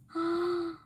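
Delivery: sharp, audible intake of breath; shocked gasp. Real, not acted. Streamer hears of the factory heat, shocked gasp. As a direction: gasp in dismay.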